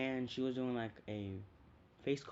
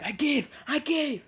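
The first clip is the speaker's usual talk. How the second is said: much higher, loud yelping give-up; high surrender cry; 12 dB louder than usual. Retold or imitated. Character giving up mid-fight, high loud yelp. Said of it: "then she's like".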